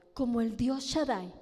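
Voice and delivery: ordinary talk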